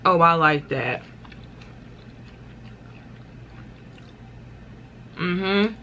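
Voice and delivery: talking while eating; chewing. Real, not acted. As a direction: mouth partly full. Seen 1: lips pressed, jaw working after eating chip; talks openly later.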